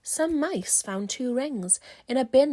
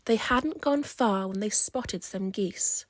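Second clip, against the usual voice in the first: ordinary delivery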